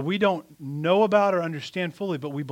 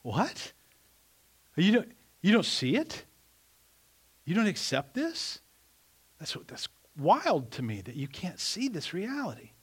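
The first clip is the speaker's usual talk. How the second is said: incredulous disbelief; 2 semitones higher than usual. Acted. Preacher acting out stepping back from an unbeliever, incredulous. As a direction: act out stunned disbelief, unable to grasp their unbelief. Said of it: "You just step back and go".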